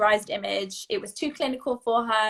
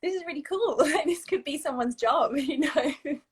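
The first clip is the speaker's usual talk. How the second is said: high, bright amused inner thought; delighted laugh running through the words; laughing excitedly; 5 semitones higher than usual. Retold or imitated. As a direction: giggle through your own excitement. Retells own excited thought, laughing.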